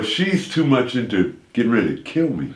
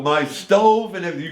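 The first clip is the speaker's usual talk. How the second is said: put-on furious old woman; 7 semitones higher than usual. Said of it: "This old eighty-year-old woman".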